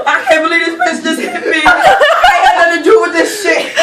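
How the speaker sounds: hammed-up crying, played for laughs; mock crying. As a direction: imitate someone whining through tears, half laughing. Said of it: "this bitch started crying".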